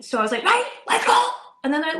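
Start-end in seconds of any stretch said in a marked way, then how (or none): 0.4-1.3 high, loud fed-up order; furious mum ordering kids out; fuming. Retold or imitated. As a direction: bark it out fuming.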